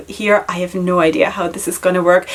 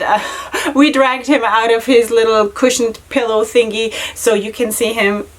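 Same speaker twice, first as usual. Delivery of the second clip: usual speaking voice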